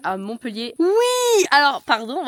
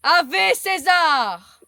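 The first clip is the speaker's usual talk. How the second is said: solemn salute; 8 semitones higher, 9 dB louder, much slower than usual. Acted. Mock Roman salute.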